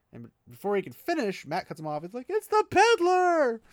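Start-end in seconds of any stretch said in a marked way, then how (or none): 2.3-3.6 much higher, louder, slowed cry of surprise; shocked recognition. Retold or imitated. Mat blurting out to the room that he knows the man, shocked. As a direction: yell it the moment you recognize him.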